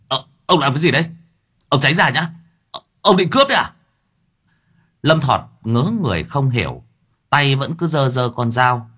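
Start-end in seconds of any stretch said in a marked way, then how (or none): 0.1-3.7 rising higher and louder, fast at the end; panicky. A narrator's reading. Driver Hà fearing a robbery, panicking higher and louder. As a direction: panic climbing toward a yelp.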